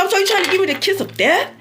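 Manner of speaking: fast, high-pitched questioning; indignant retold challenge. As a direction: fire the question out quick and high. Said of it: "I was talking just like this".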